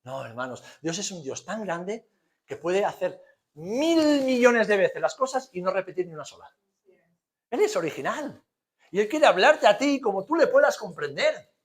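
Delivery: ordinary talk, nothing marked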